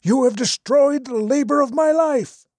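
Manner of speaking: high, furious accusing cry; angry old man. Acted. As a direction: rage at him for ruining everything. Philosopher's angry outburst quoted.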